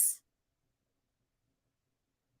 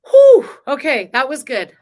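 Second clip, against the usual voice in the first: shaking it off; 4 semitones higher, 13 dB louder than usual. Real, not acted. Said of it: "get it out, shake it out".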